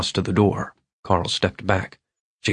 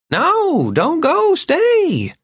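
very high, louder squeal; pleading drunk Vern; 4 dB louder, much slower than usual. Acted. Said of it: "belched suddenly".

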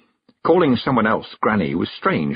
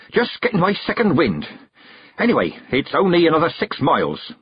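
out of breath; 7 semitones higher than usual. Acted. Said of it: "She sounded out of breath".

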